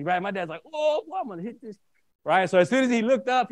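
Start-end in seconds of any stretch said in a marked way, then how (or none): 0.7-1.8 high-pitched mock outrage as Dad; retold exasperated threat. Dad reacts to his son's accusation, retold exasperated threat. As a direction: imitate Dad losing patience, pitch way up.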